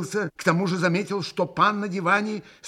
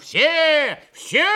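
loud, very high exclamation; восклицая; 13 semitones higher, 7 dB louder than usual. A narrator's reading. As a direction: cry it out, welcoming everyone in. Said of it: "восклицал Митя". (громкий восторженный возглас)

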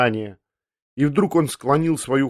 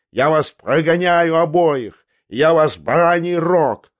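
high, loud, slow rage; furious yell; 5 semitones higher, 5 dB louder, slower than usual. Acted. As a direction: bellow the threat, dragging out the words. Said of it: "завопил Ламберт".

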